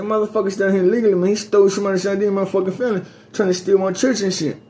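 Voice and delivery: high-pitched, urgent retelling to the cops; retold accusation. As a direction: push it up and out, pleading your case.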